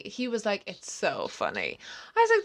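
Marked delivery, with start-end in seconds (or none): 0.7-1.8 much lower, softer put-on reply; mock amused bookseller. Bookseller insisting to an offended customer, lightly mimicked low reply. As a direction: lightly imitate him, dropping low and soft. Bookseller's answer in retold argument.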